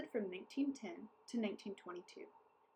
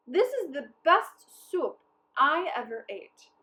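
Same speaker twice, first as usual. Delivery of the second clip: louder, higher, slow praise; delighted mayor; 6 semitones higher, 13 dB louder, slower than usual. A narrator's reading. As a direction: rave about the soup. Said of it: "said the mayor".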